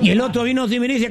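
ordinary delivery

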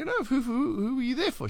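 high, insistent quoted question; animated retold question. Host retelling how he asked whom she supported, high and insistent. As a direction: re-enact pressing curiosity in a high voice.

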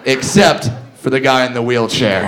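normal talking voice

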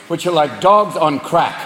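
ordinary talk

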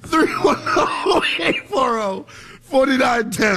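high, squeaky and slow through real laughter; helpless laughing. Real, not acted. Host giving the call-in number, laughing helplessly. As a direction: fight giggles while reading out a number. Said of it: "I'm laughing because".

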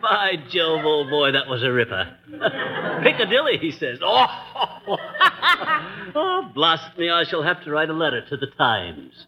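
hammed-up posh British gent; a light put-on English accent; mock English accent. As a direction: play the jolly English squire, lightly. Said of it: "how awful you sound".

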